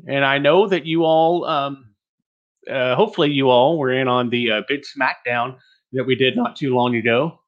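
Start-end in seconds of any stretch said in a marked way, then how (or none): none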